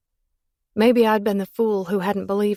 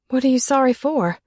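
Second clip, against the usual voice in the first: pleading; 6 semitones higher, 5 dB louder than usual. Acted. Pleading with silent lover.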